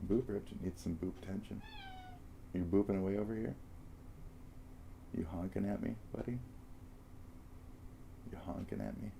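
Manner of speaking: soft cooing pet talk; silly clown. Real, not acted. Baby talk to the cat.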